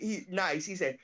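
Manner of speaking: ordinary talk, nothing marked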